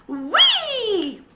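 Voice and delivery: high loud startled squeal. A narrator's reading. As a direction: let out a sudden squeal. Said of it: "unhamster-like squeal".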